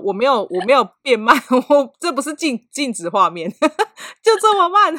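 laughing, loud and rising high; laughing protest. Real, not acted. 边笑边大声抗辩. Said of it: "我觉得你有加速".

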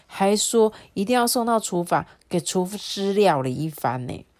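plain reading voice

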